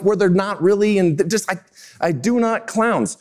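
normal talking voice

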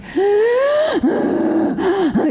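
sharp high gasp of outrage; high outraged gasp. Real, not acted. Offended wife gasping at her husband's calm put-down. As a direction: gasp as if insulted.

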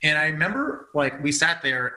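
ordinary talk

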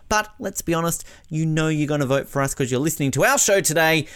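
upbeat vote-for-us appeal in promo voice; podcast promo read. Acted. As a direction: sell it brightly to the listeners. Recorded promo read asking for votes.

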